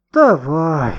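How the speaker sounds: long, falling reluctant agreement; unenthusiastic. A narrator's reading. Boy agreeing to keep watch, without enthusiasm. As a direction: drag the word out as you give in. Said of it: "без особого энтузиазма согласился Гошка".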